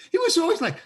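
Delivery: suddenly pitched high, a put-on alarm; mock urgency. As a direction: play up the director's big fuss. Mimicking director's urgency.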